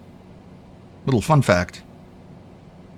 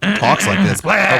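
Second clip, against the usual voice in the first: mock silly voice; 10 semitones higher than usual. Teasing friend's phrasing.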